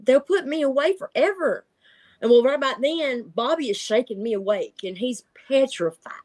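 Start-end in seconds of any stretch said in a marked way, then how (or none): 0.0-1.7 high frantic no, no; begging desperately, voice up high; a dreamer pleading with a creature; panicked pleading. Retold or imitated. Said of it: "I was like".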